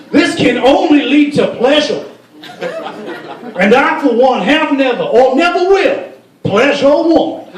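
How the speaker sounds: high, slow oratory in a mock Arabic accent. Lawmaker vowing to the assembly, high mock Arabic accent. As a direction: declare it slowly in a hammed-up Arabic accent. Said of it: "That's my Arabic accent".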